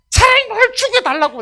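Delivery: high, loud cry of protest; defiant outcry. Acted. Acts Jonah defying God.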